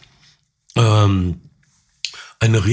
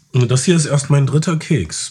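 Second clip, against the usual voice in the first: normal talking voice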